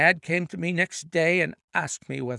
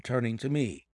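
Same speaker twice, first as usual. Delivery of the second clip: plain reading voice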